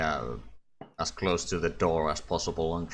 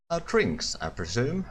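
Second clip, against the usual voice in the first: lightly acted higher-pitched query; lightly voiced Varric; 7 semitones higher than usual. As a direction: ask the dwarf tentatively, pitched up. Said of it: "Varric looks at the dwarf and says".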